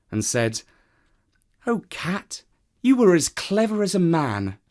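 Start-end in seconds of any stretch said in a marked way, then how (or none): 1.7-4.6 higher woman's voice, teasing; amused woman. Acted. Woman laughing at the clever cat, higher.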